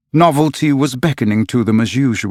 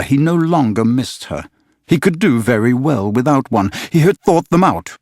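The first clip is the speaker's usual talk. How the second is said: plain reading voice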